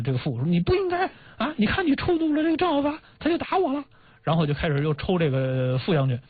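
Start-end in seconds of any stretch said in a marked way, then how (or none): 0.5-3.8 very high-pitched imitated complaint; mock scolding. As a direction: act out a high, reproachful telling-off. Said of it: "就该，就骂这个".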